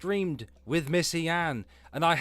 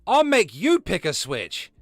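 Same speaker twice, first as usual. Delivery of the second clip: mock scolding; 3 semitones higher, 8 dB louder than usual. Defending Belinda from the stream's gossip, mock scolding. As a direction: playfully threaten a switch, loud and emphatic. Playful scolding in redneck talk.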